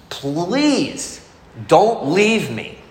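put-on a lingering, childlike plea of "Please don't leave me"; pleading. His fear of being left.

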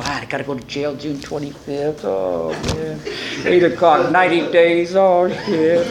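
low mock moaning; self-pitying. Drinker telling the bar about jail, moaning. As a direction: groan it out in comic self-pity.

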